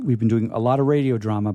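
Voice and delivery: ordinary talk, nothing marked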